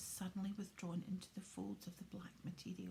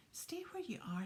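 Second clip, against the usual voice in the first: hoarse; 8 semitones higher than usual. Acted. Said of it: "in the same hoarse voice".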